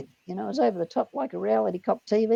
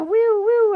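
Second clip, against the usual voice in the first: high, loud whooping siren noise; imitated police siren; 12 semitones higher, 8 dB louder than usual. Driver mimics police car pulling him over, siren whoop. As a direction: wail it like a siren. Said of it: "he was going".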